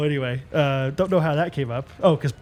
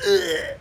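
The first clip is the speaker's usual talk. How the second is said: retching noise; 13 semitones higher than usual. Retold or imitated. Kid retching in class.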